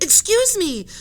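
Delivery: high, very loud call for attention; polite shout. Acted. Henry shouts to the captain and professor, high and loud. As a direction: shout over the argument. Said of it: "he shouted".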